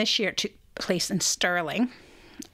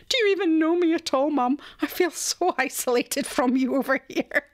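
hammed-up wounded daughter, higher and louder; mock hurt; 7 semitones higher, 3 dB louder than usual. Host tells her mum she's hurt, mock wounded. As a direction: play up mock offence at your mum.

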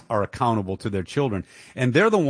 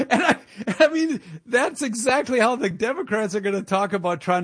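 laughing; 10 semitones higher than usual. Real, not acted. Laughing at the Democrats.

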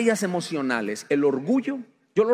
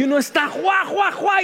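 carcajada fingida y aguda; risa imitada; 11 semitones higher, 4 dB louder than usual. Pretend. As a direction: imita una risa a todo pulmón. (risa fingida y aguda)

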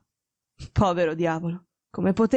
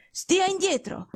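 sharp imperious order, pitched higher; curt command; 11 semitones higher, slower than usual. Acted. Sick detective ordering his friend away, curt and imperious. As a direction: snap him back from the bed. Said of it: "tono secco e imperioso".

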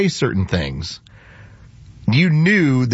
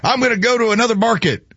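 imitating Chuck; 11 semitones higher than usual.

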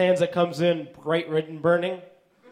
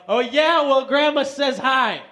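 loud, high, drawn-out retort; taunting comeback; 6 semitones higher, 4 dB louder, slower than usual. Acted. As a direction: throw it back at a boastful brother. Acted comeback to bragging brother.

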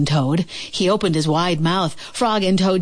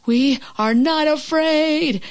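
high, slow, hammed-up scream; a half-hearted scream of bravado; frightened friends screaming they are fearless; mild mock scream; 9 semitones higher, slower than usual. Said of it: "screamed Frog and Toad".

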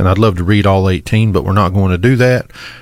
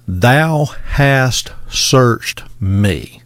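solemn scripture reading; 2 semitones higher, much slower than usual. Acted.

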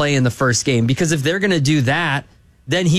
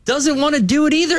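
normal talking voice

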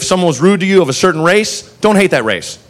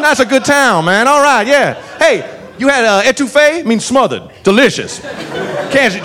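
beaming local giving tourist tips; jolly Southern cop; 5 semitones higher than usual. Retold or imitated. Proceeds to give us tourist tips.

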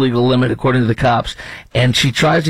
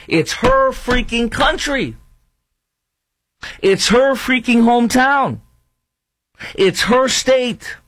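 outraged; 11 semitones higher, slower than usual. Real, not acted. Outraged rant about victim's country.

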